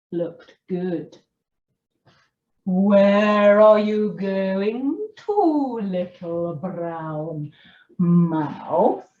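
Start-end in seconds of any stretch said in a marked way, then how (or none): 2.6-9.1 slow, drawn-out snake voice; slithery snake. Acted. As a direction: let each word slide like a snake.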